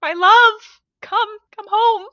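high, hammed-up pleading cry; mock heartbroken lover. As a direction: ham up a dramatic K-drama lover begging. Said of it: "A dude just being like".

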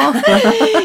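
bright high laugh. Real, not acted. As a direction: laugh along happily. Laughs at reaction.

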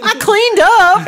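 put-on high, slow little-kid voice; guilty kid voice. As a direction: squeak it like a caught child. Playing caught kid.

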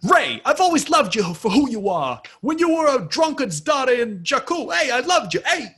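high, loud, hammed-up Italian accent; big jolly Italian pizza chef; overly Italian guy. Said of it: "retiring the overly Italian guy impression".

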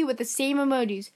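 usual speaking voice

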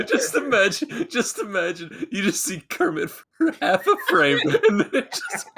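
high-pitched words squeezed through giggles; real helpless laughter. Real, not acted. Picturing Kermit in the video, really cracking up. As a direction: barely get it out for laughing.

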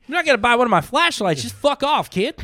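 high, loud, fast mock yell; gruff older comic. Big Tim yelling at a newbie comic, high and loud. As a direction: bark at the new kid for bitting. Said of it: "impersonating Big Tim".

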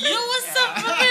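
very high, loud intro shout; mock YouTuber greeting. Copying friend's joke English intro, high YouTuber shout. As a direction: parody an over-hyped channel opener. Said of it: "Jadinya ntar kayak YouTuber".

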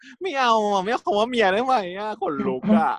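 high whiny protest, squirming at the word; squeamish whine. Retold or imitated. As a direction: pitch it way up like you're cringing. Khan squeamish at word wife.

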